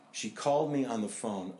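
usual speaking voice

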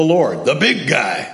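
imitated friend's way of speaking; Neil Mansfield. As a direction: quote the friend's pet phrase in his voice. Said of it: "That's just the way Neely Joe talked".

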